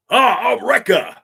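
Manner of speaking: hammed-up big-bruiser clone shout; Wrecker voice. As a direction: boom it like a hulking clone. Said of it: "I can do a Clone Trooper voice".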